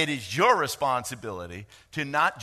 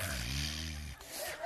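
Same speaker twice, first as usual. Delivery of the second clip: long deflated sigh; heavy weary sigh; tired sigh; 12 dB quieter than usual. Retold or imitated. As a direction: exhale like three hours wore you out. Worn out after long prayer.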